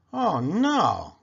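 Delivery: mild dismayed cry. Acted. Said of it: "cried Miss Muffet".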